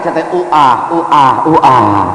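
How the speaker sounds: put-on childish lisp on uah uah; lisping cadel speech. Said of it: "aing ge jadi milu cadel".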